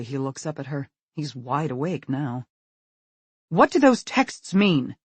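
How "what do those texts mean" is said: slow, loud, stern; stern demand. A narrator's reading. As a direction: press him hard. Angry mother questioning.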